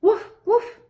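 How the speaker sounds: high loud playful woof; mock dog sound. Shown as a demonstration. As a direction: bark like a friendly dog. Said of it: "you just do woof".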